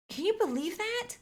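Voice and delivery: high, incredulous gossip voice; indignant gossip. Acted. Teen daughter gossiping to dad, scandalised high voice. Gossiping about Emma's texts.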